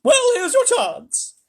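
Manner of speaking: put-on very high, loud, slow old granny; old grandma snarl; Grandma Joe voice. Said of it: "I'm doing Grandma Joe's voice".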